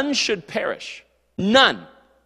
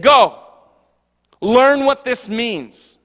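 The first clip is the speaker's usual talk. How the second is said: stern command; 4 semitones higher, 6 dB louder, much slower than usual. Pretend. Jesus scolding scholars.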